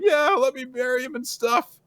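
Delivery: high-pitched, imitated happy crying; acted weepy squeak; mock tearful. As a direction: plead through pretend tears. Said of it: "She's crying".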